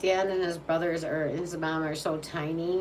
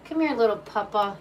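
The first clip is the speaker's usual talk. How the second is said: higher, cooing pet voice; sweet talk to the puppy; 4 semitones higher, 5 dB louder than usual. Real, not acted. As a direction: coo at the little dog. Cooing at the dog.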